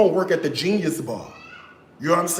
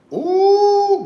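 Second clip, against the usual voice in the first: loud, high-pitched whoop; high "ooh"; 8 dB louder than usual. Real, not acted. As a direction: let out a long high "ooh". Exclaims between remarks on meme.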